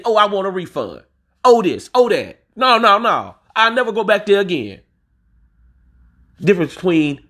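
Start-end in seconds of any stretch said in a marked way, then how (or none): 0.0-4.8 higher, whiny mock complaint; imitating picky customers. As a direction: mimic a fussy customer demanding a refund. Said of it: "The smallest thing".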